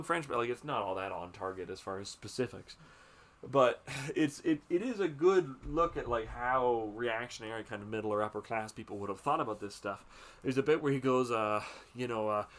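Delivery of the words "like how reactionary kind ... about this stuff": ordinary talk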